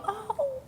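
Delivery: soft, high-pitched "oh"; high little melting "oh"; cooing "oh". Retold or imitated. Cooing at pictures of little girls.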